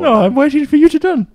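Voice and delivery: high squeaky mock kid voice; silly childish voice. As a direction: whine it like a little kid. Mocking childish voice.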